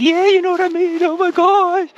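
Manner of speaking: very high, loud, gushing; excited squeal. Retold or imitated. Meeting another energy sensitive person, squealing with excitement. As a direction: burst with delight at a kindred spirit. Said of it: "you're like".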